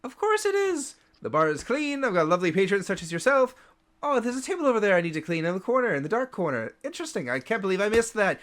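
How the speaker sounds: high, rapid overly sweet chatter; flustered bartender. Acted. Dazed bartender chatting to the elf, overly sweet and rapid. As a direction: babble cheerfully to hide confusion.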